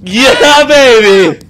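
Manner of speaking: loud, high victory cheer; triumphant celebration. Real, not acted. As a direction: cheer like you just won. Celebrating the win.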